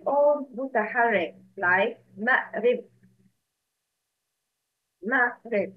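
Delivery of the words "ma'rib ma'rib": "ma'rib" with the hamza sounded, splitting the word; separated hamza. Shown as a demonstration. Teacher gives students an example of hamza qata, hamza sounded in "ma'rib". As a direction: pronounce "ma'rib" with a catch before "rib". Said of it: "It separates the pronouncing of a word".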